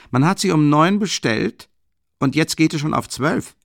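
loud, high angry rebuke; angry lieutenant scolding the late student; furious scolding. A narrator's reading. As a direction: scold him hard for coming late.

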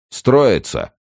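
high, loud drill order; barked command. A narrator's reading. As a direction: call the group to line up. Gendarme orders captives into line.

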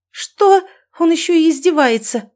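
high, loud indignation; outraged outburst. A narrator's reading. Mother outraged at son's teasing, outraged outburst. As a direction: explode at being mocked.

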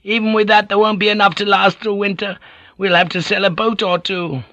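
high anxious villager voice; high-pitched Ninjin fretting about winter; worried fisherman. Acted. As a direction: fret in the high whining voice. Ninjin's voice in dialogue.

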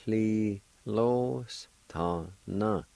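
li lo s ta na drawn out slowly; slow model. Shown as a demonstration. Teacher modelling the word slowly for learners. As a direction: stretch each syllable of li lo s ta na. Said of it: "is pronounced like this".

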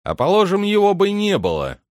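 calling after him. A narrator's reading. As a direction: shout it after someone who is leaving. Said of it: "крикнул ему вослед Дворецкий". (окрик вдогонку)

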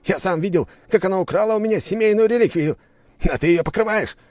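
high-pitched raging aristocrat; indignant, loud, high rant; shouted tirade. A narrator's reading. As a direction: yell accusations and threats all round. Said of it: "снова закричал аристократ".

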